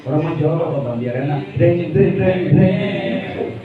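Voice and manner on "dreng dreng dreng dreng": playful sung guitar sound; a preacher mimicking a guitar strum, singing "dreng, dreng". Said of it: "gue nyanyi".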